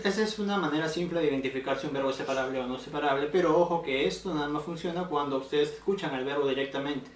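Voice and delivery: usual speaking voice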